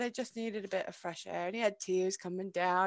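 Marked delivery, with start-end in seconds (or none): 0.0-1.5 put-on much softer, a sad little boy; hushed imitation of an upset kid; a child's small voice. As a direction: quietly voice a tearful son. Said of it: "And he said".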